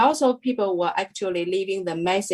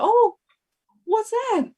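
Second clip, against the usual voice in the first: excited surprise. Retold or imitated. Retelling hearing New Zealand named in the blessing, excited high exclamation. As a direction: squeal it with delight, reliving it. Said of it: "So I was very excited".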